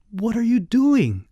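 high-pitched, incredulous demand; exasperated. A narrator's reading. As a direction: scold a silly uncle in disbelief. Scolding uncle hiding under bed.

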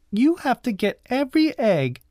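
put-on high-pitched bossy girl; high voice of a child in charge; bossy cousin. A narrator's reading. Bossy cousin giving orders.

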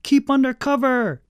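high-pitched, urgent warning cry; urgent boyish yell; excited shout. A narrator's reading. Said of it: "he shouted at Uncle Al".

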